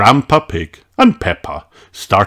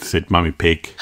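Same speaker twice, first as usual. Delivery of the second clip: ordinary delivery, nothing marked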